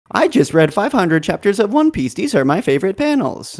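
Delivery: high, even text-to-speech voice; automated lady voice. Retold or imitated. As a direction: read it like a TikTok robot narrator. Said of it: "the automated lady voice".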